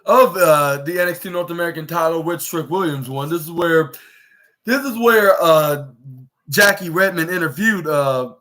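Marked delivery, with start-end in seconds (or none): none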